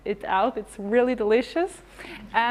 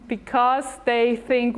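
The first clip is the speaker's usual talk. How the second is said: ordinary delivery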